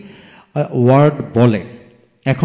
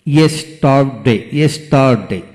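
"Yesterday" broken as yes, টার, ডে; three separate beats in "yesterday"; split into syllables. Shown as a demonstration. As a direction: sound out "yesterday" piece by piece. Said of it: "যতটুকু উচ্চারণ করা যায় ততটুকু অংশকে syllable".

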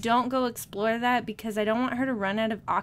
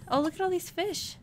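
ordinary talk

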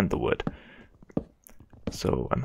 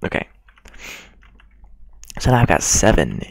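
normal talking voice

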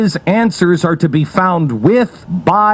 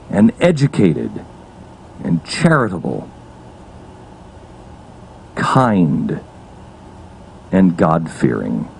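low, hushed list with long pauses; sparse, low-pitched radio monologue; solemn oratory; 13 semitones lower, 5 dB quieter than usual. Acted.

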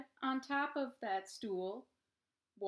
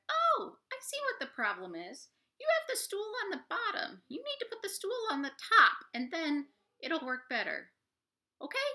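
high, quick, eager mouse voice; chirpy little mouse explaining; clever mouse; 10 semitones higher, 5 dB louder, faster than usual. Acted. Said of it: "The mouse said".

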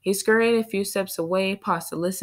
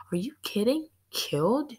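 hushed and rising in disbelief; shocked whisper; 5 semitones higher, 4 dB quieter than usual. A narrator's reading. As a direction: gasp it out quietly, stunned. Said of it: "Early whispered back".

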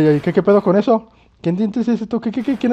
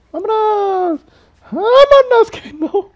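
excited shout; 5 dB louder, slower than usual. Real, not acted. Excited calling to leave.

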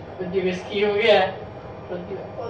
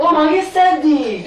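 loud protest; 6 semitones higher, 6 dB louder than usual. Real, not acted. Protesting while blindfolded.

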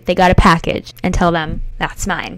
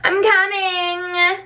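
calling out; 10 semitones higher, much slower than usual. Real, not acted. Calling out to mom.

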